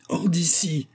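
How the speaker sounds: high-pitched, angry dismissal; irritated order. A narrator's reading. Irritated mistress ordering the servant out, angry. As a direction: throw her out, pitch shooting up. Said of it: "répéta la matrone irritée".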